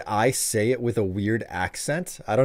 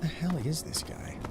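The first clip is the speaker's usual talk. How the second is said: voiceless whisper, a little higher; puzzled whisper; 6 semitones higher, 10 dB quieter than usual. Real, not acted. Streamer eyeing a strange game character, whispering. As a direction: whisper it, baffled.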